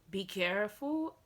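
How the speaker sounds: normal talking voice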